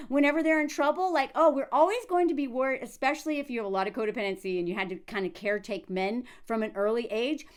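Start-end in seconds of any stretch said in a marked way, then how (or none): none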